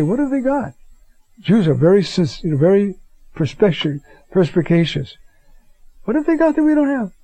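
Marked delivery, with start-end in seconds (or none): 0.0-0.7 high, incredulous question; skeptical Palestinian asking. Retold or imitated. Palestinian prayer group member asking, high and incredulous. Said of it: "But they say". 6.0-7.1 high, quick, disbelieving question; incredulous Palestinian. Retold or imitated. Prayer group member to the preacher, high fast disbelief. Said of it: "But they say".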